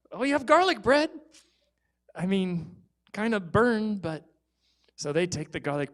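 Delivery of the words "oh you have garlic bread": high, loud put-on surprise; mock delight. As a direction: pretend to be thrilled about the bread. Mom feigns delight.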